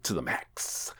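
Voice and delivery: unvoiced whisper; a whispered throwaway; whispered aside. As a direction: breathe it out as a secret aside.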